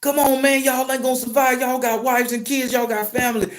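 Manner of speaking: high-pitched desperate pleading; acted bawling. Driver chasing the bus, begging the crew, acted bawling. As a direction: imitate begging through tears, high-voiced. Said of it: "And I'm bawling".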